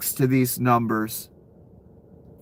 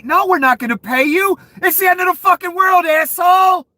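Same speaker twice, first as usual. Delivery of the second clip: higher, louder scornful company rep; mock company reply; 5 dB louder than usual. Company yelling at the winner it won't pay. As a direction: shout the refusal like a fed-up clerk. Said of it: "be like".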